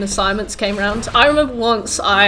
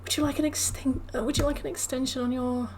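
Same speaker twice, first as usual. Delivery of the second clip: much higher and much softer, a caring nurse; gentle nurse; 4 semitones higher, 8 dB quieter than usual. Retold or imitated. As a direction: coax her softly like a kind nurse. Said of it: "And then she was like".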